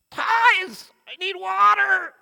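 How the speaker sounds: high, loud desperate yell; desperate shout. Retold or imitated. Thirsty crawling man yells at the tie seller, desperate shout. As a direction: cry out as if dying of thirst.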